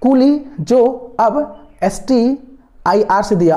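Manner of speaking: very slow, pauses between chunks; teacher slowly drilling a mnemonic; careful slow dictation. Shown as a demonstration. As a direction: spell out the trick phrase piece by piece. समझिएगा इसको अच्छे से.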